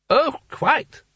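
put-on high, slow, wandering old ruler; dreamy old Seraph. A narrator's reading. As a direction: drift through the words like a tipsy old poet. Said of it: "the Seraph burped gently".

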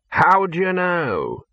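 slow, drawn-out suspicion; suspicious. Acted. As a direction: narrow in on him. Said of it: "said the Arch-Chancellor suspiciously".

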